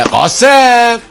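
high, slow loud call. Acted. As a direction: shout his name across the shop. Calling the assistant. (صدا زدن بلند)

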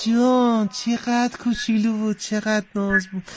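put-on high, soft baby-talk coo. Host fussing over the contestant's child voice, cooing. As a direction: coo over a cute little one.

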